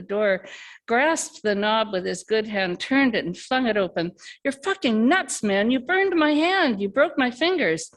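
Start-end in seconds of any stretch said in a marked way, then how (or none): 4.4-7.9 higher, raised, accusing through pain; hurt and furious. Acted. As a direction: yell back clutching the broken hand. Tim hurt, escaping.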